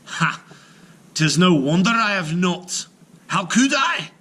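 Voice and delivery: loud high mocking giant voice; scoffing giant. Giant scoffing at Finn, loud mockery. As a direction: scoff loudly like a boastful giant.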